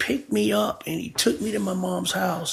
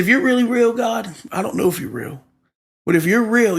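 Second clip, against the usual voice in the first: retold challenge to God; 5 semitones higher, 4 dB louder than usual. Retold challenge to God over funeral.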